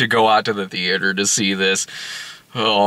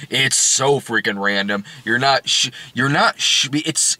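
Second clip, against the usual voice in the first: ordinary talk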